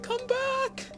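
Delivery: very high-pitched cry; squeaky high yell. Retold or imitated. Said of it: "It's like".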